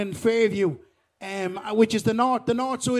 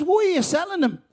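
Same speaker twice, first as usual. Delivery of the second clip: much higher, incredulous questioning; baffled question; 7 semitones higher than usual. Acted. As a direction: ask it in acted disbelief. Buyer baffled at the sale.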